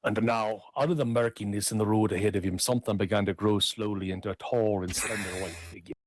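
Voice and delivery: lively Irish tale telling; lilting Irish storyteller. A narrator's reading. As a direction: spin the yarn with a lilt. Reads Darby O'Gill aloud.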